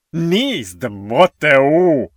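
honeyed doctor, swooping high; oily friendliness. A narrator's reading. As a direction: coax him with put-on friendliness. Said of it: "magyarázott nyájas hangon doktor Rosedale".

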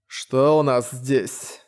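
slow, lisping voice; slushy lisp. Acted. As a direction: lisp it out slowly. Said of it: "Странный шепелявый голос".

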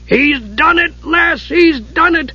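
high, gleeful dwarf cry; excited shriek. Acted. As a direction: crow with joy at the hit. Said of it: "Dugan shrieked".

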